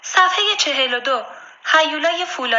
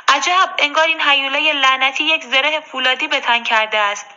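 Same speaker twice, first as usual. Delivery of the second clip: plain reading voice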